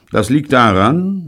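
high, loud and haughty. A narrator's reading. Bunny putting envious Robert in his place, haughty. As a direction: put him down from on high. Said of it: "meinte Bunny hochfahrend".

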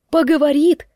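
loud disbelieving question; alarmed question. Acted. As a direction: push the question up in rising alarm. Repeats his words, more alarmed.

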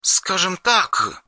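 lightly voiced young woman, high, soft and slow; a soft, hesitant girl on the phone; Yulia's voice. Yulia to the hero on the phone, lightly voiced and hesitant.

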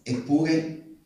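ordinary delivery

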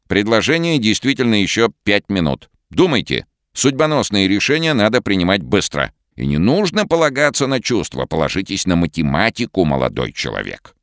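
put-on old professor voice. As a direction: speak as a smug, pushy professor. Professor offers deal, lectures.

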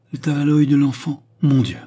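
high, loud cry of emotion; overwhelmed exclamation. A narrator's reading. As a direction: exclaim as the sight overwhelms you. Overwhelmed father sees christening cloth.

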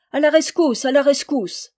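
high, loud call for help; rallying cry. A narrator's reading. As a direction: cry out to summon the troops. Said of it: "criait incessamment Méloir".

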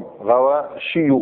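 غواشي drawn slowly, ending in a clear ياء; slow careful غواشي. Shown as a demonstration. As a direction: spell out غواشي slowly for students. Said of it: "مش غواشيون".

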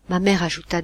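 usual speaking voice

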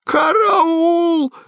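very high, wailing cry for help; high held panic cry, not booming; panicked yell. A narrator's reading. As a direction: scream for help in a thin high voice. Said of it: "заорал Квадриго".

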